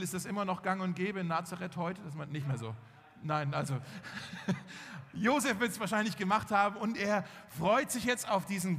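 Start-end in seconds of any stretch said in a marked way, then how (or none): 3.8-5.1 short soft chuckle. Real, not acted. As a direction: chuckle lightly at the exchange. Joking with a listener in audience.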